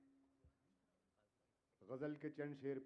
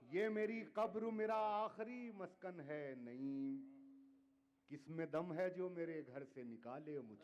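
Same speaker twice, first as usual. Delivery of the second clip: measured, declaiming poetry to audience; ghazal recitation; 4 semitones higher, 3 dB louder, slower than usual. Acted. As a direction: recite each couplet with weight. Poet repeats final couplet.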